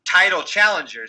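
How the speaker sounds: usual speaking voice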